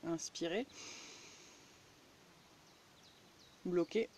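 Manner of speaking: guided breathing. Acted.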